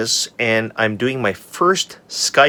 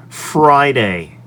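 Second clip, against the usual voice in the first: ordinary talk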